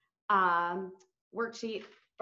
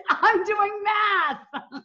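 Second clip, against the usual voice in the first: laughing; 9 semitones higher, 9 dB louder, much slower than usual. Real, not acted. Laughing at being asked about math.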